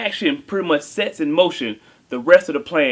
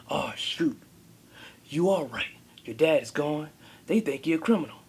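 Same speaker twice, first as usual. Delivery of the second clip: softer, half-whispered confiding voice; hushed butler; 4 dB quieter than usual. Retold or imitated. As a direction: lean in and tell secrets under your breath. Butler confides secrets to kid.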